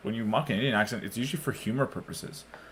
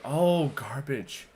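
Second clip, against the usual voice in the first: drawn-out, higher "oh, garbage"; mimicked realisation; 4 semitones higher, much slower than usual. Imitating listeners who finally understand, slow dawning recognition. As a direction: stretch it out as the penny drops. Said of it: "they're like".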